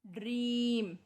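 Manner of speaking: normal talking voice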